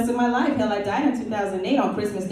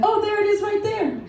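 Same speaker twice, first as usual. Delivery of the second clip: mock surprised spotting; 12 semitones higher than usual.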